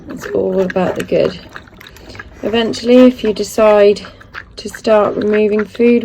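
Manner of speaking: ordinary talk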